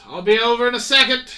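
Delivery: brisk captain. Acted. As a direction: wave the sailor off, coming right over. Captain answers sailor.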